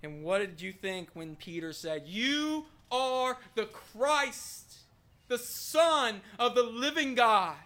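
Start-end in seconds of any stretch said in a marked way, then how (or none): 2.1-7.6 higher, very slow, stretched declaration; bold proclamation. Acted. Peter answering who Jesus is, bold drawn-out proclamation. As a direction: declare it with swelling conviction. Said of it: "wanted to shout at the top of your lungs".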